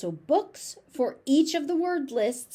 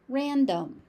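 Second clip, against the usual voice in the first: ordinary delivery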